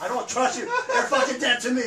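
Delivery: laughing mock threat. Real, not acted.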